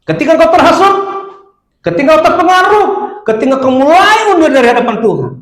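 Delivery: high, urgent, rising pulpit cry; forceful preaching. Real, not acted. (khotbah lantang menggebu-gebu)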